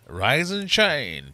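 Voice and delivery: slow, loud sing-song bingo call; drawn-out bingo call; bingo caller. Acted.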